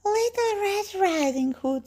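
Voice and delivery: very high fake little-girl voice; wolf posing as girl. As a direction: pitch it way up to pass as a girl. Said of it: "says the wolf".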